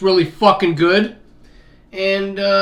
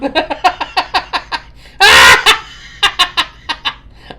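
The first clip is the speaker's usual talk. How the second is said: loud high laughing fit; 12 semitones higher, 9 dB louder than usual. Real, not acted. Laughing at Donna's retort.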